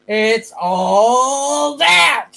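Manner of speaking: slow high drawn-out shout; announcer shout. Pretend. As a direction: belt it like a show intro. Announcing revived show name.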